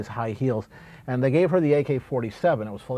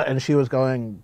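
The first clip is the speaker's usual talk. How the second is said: normal talking voice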